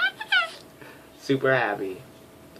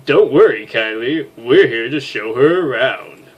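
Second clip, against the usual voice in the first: louder, lightly put-on friendly AI; cheerful robot helper; 3 semitones higher, 9 dB louder than usual. AI reassuring the major's crew, bright robotic cheer. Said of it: "Should I give her Saria's voice?".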